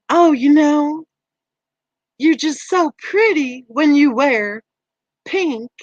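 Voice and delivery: sweet Southern drawl hiding an insult. Shown as a demonstration. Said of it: "Or something like".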